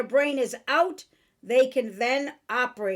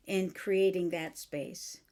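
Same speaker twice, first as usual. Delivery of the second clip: ordinary talk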